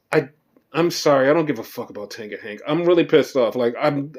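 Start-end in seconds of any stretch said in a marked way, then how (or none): 1.3-2.6 fast annoyed mutter. Real, not acted. Annoyed aside about wrestlers.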